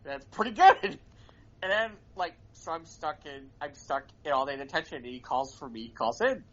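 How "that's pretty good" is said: retold dean stifling a laugh, a bit higher and louder; holding back laughter. Dean admiring the prank against his will, holding back laughter. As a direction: imitate someone trying not to laugh. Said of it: "he's trying not to laugh".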